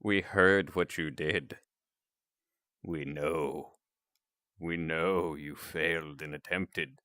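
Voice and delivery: low, slow, hushed mock reproach; disapproving Australians. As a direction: quietly let them know they have been found out. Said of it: "to be like".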